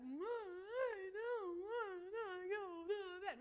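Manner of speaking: slow, high, sobbing wail; crying toddler. Retold or imitated. Mom imitating son crying down the stairs, slow high wail. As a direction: drag it out like a bawling toddler. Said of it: "every step".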